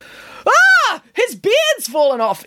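high, loud cry of shock; shocked exclamation. A narrator's reading. Nanny finding Santa's beard in her trotters, shocked exclamation. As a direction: yelp it out, pitch shooting up. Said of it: "exclaimed Nanny Piggins".

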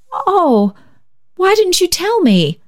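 high dismayed cry. A narrator's reading. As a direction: plead in sudden dismay. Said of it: "cried Barbara in dismay".

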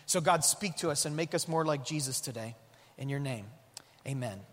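hushed, earnest prayer to God. Acted. Pastor praying before the sermon, hushed and earnest. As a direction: pray softly over the congregation.

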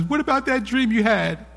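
lightly voiced wife, curious; wife's voice. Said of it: "Then she says".

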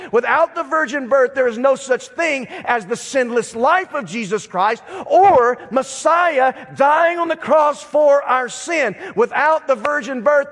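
high-pitched, insistent preaching; forceful preaching. Real, not acted. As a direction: drive the doctrine home from the pulpit.

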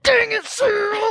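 high, drawn-out "dang it" outburst; mock frustrated yell. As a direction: act out a sore loser's cry. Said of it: "I was like".

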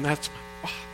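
breathy whisper of wonder; awed whisper. Retold or imitated. Pastor recalling seeing a rainbow, whispered in awe. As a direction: hush it like a secret discovery.

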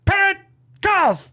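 very high mock announcer squeal; high-pitched game show shout; game title callout. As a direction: call it out in a silly high voice.

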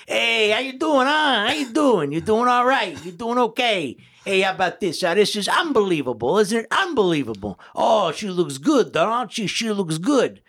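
loud, high, excited party greeting; uncle at a wedding. Pretend. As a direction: boom it out like a thrilled uncle at a reception. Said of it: "That's at a wedding".

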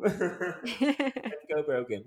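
slow laughing chant; laughing cheer. Pretend. Cheering for Brogan.

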